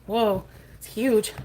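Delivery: slow, amazed whoa. Real, not acted. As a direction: draw it out in awe.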